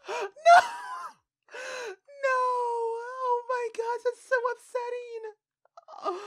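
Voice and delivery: high, loud crying cry of no; real tearful outcry; real crying shout. Real, not acted. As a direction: wail it out, very slow on the last words. Gasps at the sight, grieving.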